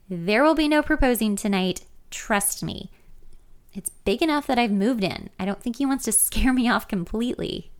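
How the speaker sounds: ordinary delivery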